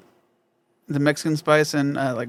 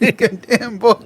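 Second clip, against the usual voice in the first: laughing catchphrase; 7 semitones higher, much slower than usual. Retold or imitated. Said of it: "repeating catchphrase".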